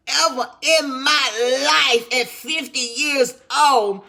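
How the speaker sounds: high, loud and slow with pure thrill; excited. Real, not acted. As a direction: let the amazement burst out, stretching it. Thrilled about her first flight.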